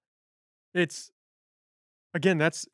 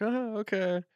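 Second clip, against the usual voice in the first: high, awkward little acknowledgement; uncomfortable mock laugh; 8 semitones higher than usual. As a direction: imitate a nervous laugh to get past discomfort. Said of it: "you can laugh when you're uncomfortable".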